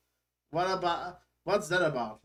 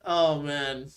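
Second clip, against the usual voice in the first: usual speaking voice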